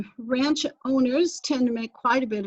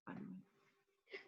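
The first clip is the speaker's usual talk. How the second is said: whispered aside; 26 dB quieter, slower than usual. Real, not acted. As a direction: drop to a slow whisper. Whispered aside about barbed wire.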